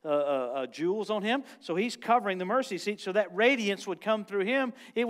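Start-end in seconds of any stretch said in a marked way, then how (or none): none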